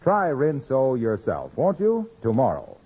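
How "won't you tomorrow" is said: ordinary talk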